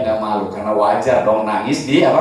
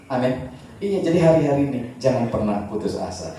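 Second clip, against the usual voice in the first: ordinary talk, nothing marked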